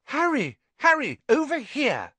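put-on very high girlish call. Friend calls to boy from the steps, high excited call. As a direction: hail a friend from a distance, high and urgent.

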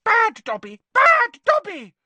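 high, loud self-scolding shriek; frantic elf punishing himself; squealing. A narrator's reading.

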